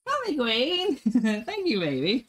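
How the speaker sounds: very high delighted cry; thrilled by the gift; high squeal of thanks; excited squeal. Real, not acted.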